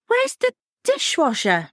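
high, harried friend voice; hint of a stressed friend. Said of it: "She sounded stressed".

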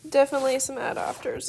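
ordinary delivery

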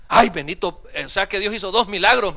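loud, acted amazement at a miracle; astonished exclamation. Church member marvels to the pastor at two miracles, loud astonishment.

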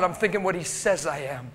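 ordinary talk

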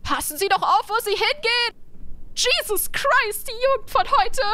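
put-on high, indignant scolding old woman; indignant old lady voice. Voicing an indignant old person.

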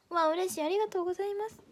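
high, bright happy thanks; excited thanks. Real, not acted. Streamer thanking viewers for pushing her rank up, excited. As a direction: gush with joy.

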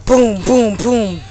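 punchy mouth-made gun sounds; gunshot noises. Acted. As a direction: blast out booms like a kid playing shooter. Imitating gunfire in game.

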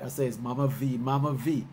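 usual speaking voice

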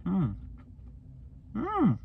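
savoring food, lips closed; chewing. Real, not acted. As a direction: mouth full, humming while chewing. Seen 0: Bit bar at -5s; closed mouth moving, eyes shut while humming.

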